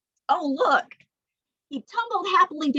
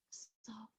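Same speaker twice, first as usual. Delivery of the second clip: soft breathy whisper of the name; 27 dB quieter than usual. Shown as a demonstration. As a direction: barely breathe it out. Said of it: "one named Soft".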